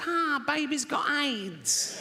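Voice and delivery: high, drawn-out mock cry; gleeful punchline. Comic delivers his only punchline, high mock-gleeful cry. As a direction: sing out the punchline high, stretching each word. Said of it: "all I've got is".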